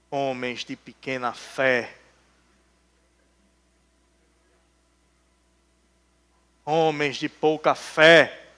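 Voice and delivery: Jesus gently rebuking his disciples, low; soft rebuke. Retold or imitated. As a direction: sigh out the reproach.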